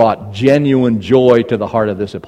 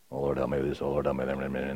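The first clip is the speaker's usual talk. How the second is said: very low, hushed mumbling under the breath; mock muttering to yourself, trailing into a blur; mumbled prayer; 9 semitones lower, 17 dB quieter than usual. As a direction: imitate someone praying constantly under their breath. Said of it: "mumbling to yourself under your breath".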